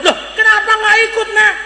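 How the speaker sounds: high, incredulous mother's question; startled questioning. Acted. Mother asks son why he skipped, startled.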